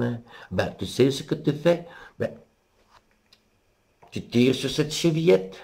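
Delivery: mémé, voix plus aiguë, un peu plus fort; vieille grand-mère. Acted. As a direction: vieille dame gentille, voix perchée. La grand-mère répond au loup. (la grand-mère au loup, voix aiguë)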